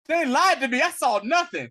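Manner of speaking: high, loud sulky protest; mock-defiant outburst. Shuri refusing to tell the elders who she saw, high loud sulky outburst. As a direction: snap it out like a stubborn kid.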